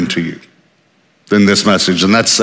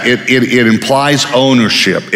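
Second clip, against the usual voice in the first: ordinary talk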